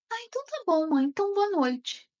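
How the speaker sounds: put-on a relieved child saying goodnight; content little boy. Son saying goodnight to his mother, high content child voice.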